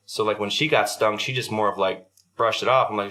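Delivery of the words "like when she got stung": ordinary talk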